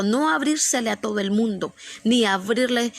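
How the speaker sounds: ordinary delivery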